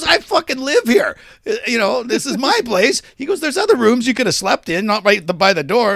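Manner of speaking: high, loud mock protest; retold indignant argument. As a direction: act out a roommate standing his ground. Retold quarrel, roommate argues back.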